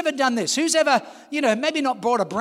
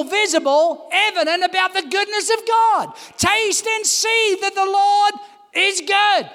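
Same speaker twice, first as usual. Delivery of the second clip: full-force preaching; 5 semitones higher than usual. Acted. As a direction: build the congregation up with fervour.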